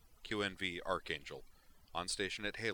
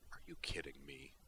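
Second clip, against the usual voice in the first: low, soft, fed-up grumble; grumbling; 4 semitones lower, 7 dB quieter than usual. Acted. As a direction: mutter it into your helmet. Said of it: "he grumbled".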